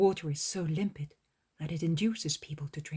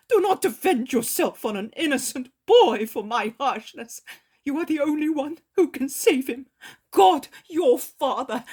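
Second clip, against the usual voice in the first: put-on high, loud, begging old Pharisee; broken grandfather begs Jesus for the boy; Eli, desperate pleading; 8 semitones higher, 12 dB louder than usual. A narrator's reading.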